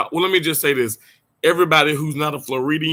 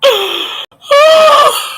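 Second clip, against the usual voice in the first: a real burst of shrieking laughter; laughing shout; 9 dB louder than usual. Real, not acted. Host cracking up in front of viewers, loud shrieking laughter. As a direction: howl with laughter, then gasp for air.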